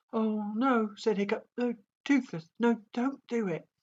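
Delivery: high, urgent begging; panicked pleading. A narrator's reading. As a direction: plead in alarm.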